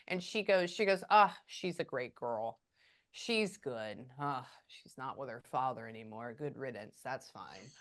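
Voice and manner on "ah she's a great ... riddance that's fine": lower-voiced, offhand older woman; quoted spirit woman. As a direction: drop lower and shrug off the ex for good. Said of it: "like that, and I quote".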